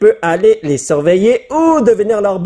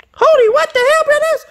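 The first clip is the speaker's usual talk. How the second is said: cri de surprise suraigu; voix qui saute très haut, incrédule; stupéfaction. Real, not acted. Découvre une carte surprenante. (stupéfait devant la carte)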